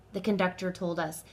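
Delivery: usual speaking voice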